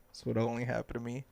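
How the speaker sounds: a quick quiet grumble to yourself; muttering. Retold or imitated. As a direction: mutter it fast to yourself in disbelief. Hat just got thrown.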